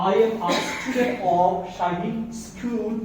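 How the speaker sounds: "I am a student of শাহিদ স্কুল" spoken very slowly; slow model sentence. Shown as a demonstration. Teacher modelling a school introduction, very slow. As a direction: stretch out every word for the class. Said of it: "আমরা যখন সেটা আমরা নিজেরাই বলি".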